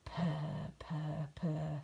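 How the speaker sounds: soft low purring; soft contented purr; cat purr. Acted. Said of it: "said Wilbur".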